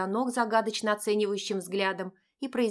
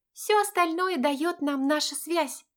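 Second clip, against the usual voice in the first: put-on high girlish friend voice; chirpy young friend; cartoon princess; 8 semitones higher, slower than usual. A narrator's reading. (высокий девичий голосок)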